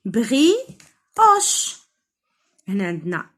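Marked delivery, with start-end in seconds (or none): 0.1-1.8 بريوش as "bri", long pause, "oche"; syllable by syllable. Shown as a demonstration. Teacher dividing words for children, بريوش broken in two. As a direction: slowly beat out بريوش in two high-pitched pieces. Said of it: "كاينين جوج ديال لي سيلاب".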